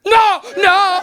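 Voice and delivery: high, emphatic refusal; hammed-up mom shutting it down; outraged mother. Mother rejects son's argument about weed, high outraged cry.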